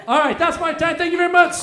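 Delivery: loud, high shout to the crowd; shouted sign-off. Real, not acted. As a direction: call out thanks over the applause. Comic shouts sign-off over applause.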